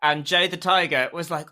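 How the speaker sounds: ordinary talk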